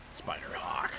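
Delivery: whispered Spider-Hawk. Shown as a demonstration. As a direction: breathe Spider-Hawk as a secret.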